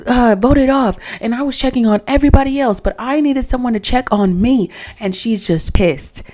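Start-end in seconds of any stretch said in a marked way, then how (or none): 0.1-4.7 high-pitched mock yell, fast in the middle; retold yelling. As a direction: shriek it like she did in the villa. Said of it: "She starts yelling".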